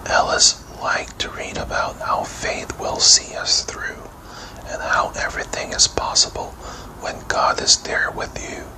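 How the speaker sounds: ordinary delivery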